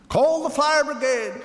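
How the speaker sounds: very high-pitched alarmed call; urgent cry. A narrator's reading. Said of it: "cried Missus Prothero".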